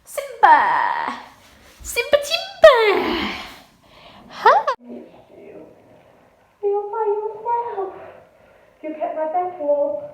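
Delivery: high, slow sing-song pet talk. Real, not acted. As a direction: coo at the cat tenderly. Greeting her cat.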